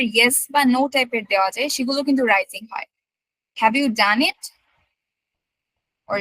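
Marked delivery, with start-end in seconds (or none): 3.6-4.4 "done it" lifting at the end; yes-no question, pitch going up on "it"; rising question tune. Shown as a demonstration. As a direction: end "Have you done it" on a rise. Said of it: "এগুলো হবে rising".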